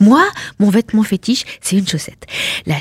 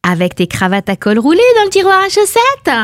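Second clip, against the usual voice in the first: mock helpful; 9 semitones higher than usual. Wife mocking husband's packing, arch sarcastic voice. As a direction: offer it with sweet irony. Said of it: "J'ai proposé".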